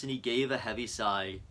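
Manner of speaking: usual speaking voice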